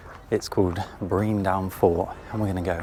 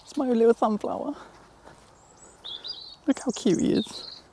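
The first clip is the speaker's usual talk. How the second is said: high, cutesy voice, much higher. Real, not acted. Showing off her sunflower, high cooing baby voice.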